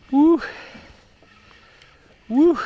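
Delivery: high, loud "woo"; excited whoops. Real, not acted. Angler with a big fish on, excited whooping.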